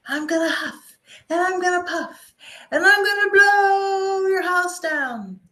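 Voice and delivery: high, loud, swelling wolf threat; big bad wolf. Wolf threat in fairy tale.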